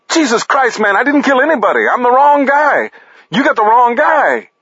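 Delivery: very high panicked wail; frantic pleading, pitched to the top; shaking, wailing. A narrator's reading. Paris begging Jay at gunpoint, wailing. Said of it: "Shaking, wailing".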